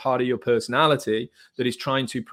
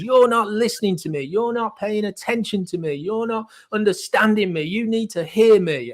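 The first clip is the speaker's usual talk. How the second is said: put-on high, loud protesting child; angry child; 10 semitones higher, 5 dB louder than usual. Angry child demanding to be listened to, high protest. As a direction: act out a child's insistent, hammed-up demand to be heard. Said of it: "your angry child kicks in and goes".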